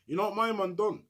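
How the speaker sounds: ordinary delivery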